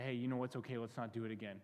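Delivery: low, hushed, forgiving; merciful reassurance. Acted. God taking back the burned, gently reassuring. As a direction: comfort softly, voice dropping away. God shows mercy after the burn.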